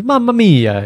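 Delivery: high, loud exclamation; exclaimed mamma mia. Shown as a demonstration. Showing the Italian phrase to his co-host, high and loud. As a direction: throw it out as a cry. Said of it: "It depends on the inflection you put on".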